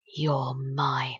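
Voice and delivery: low, soft, near-whispered claim; hushed possessive murmur. Acted. Poem's killer claims the trembling lover, hushed and low. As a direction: drop low and almost whisper it. Menacing quote inside the poem.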